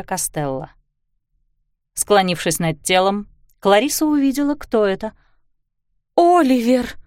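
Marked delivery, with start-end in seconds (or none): 6.2-7.0 gasped with difficulty. A narrator's reading. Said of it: "с трудом выдохнула".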